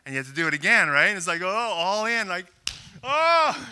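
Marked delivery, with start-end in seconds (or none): 3.0-3.7 pained yelp. Real, not acted. Pain from the pencil trick.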